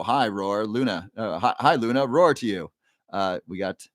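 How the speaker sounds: ordinary delivery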